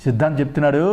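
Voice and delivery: ordinary talk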